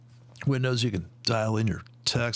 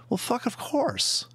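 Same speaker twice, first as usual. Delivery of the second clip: sarcastic; 11 semitones higher, slower than usual. Real, not acted. Sarcastic reaction.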